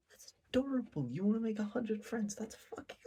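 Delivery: very high, cooing mock-delight; gushing Yamai. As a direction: coo like a creepy fangirl. Voicing Yamai gushing mockingly.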